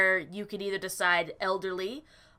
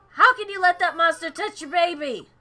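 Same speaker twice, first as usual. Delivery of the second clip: higher, louder demanding accusation; outraged scolding; 6 semitones higher, 6 dB louder than usual. Acted. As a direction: scold her for letting it near. Character scolds the mother.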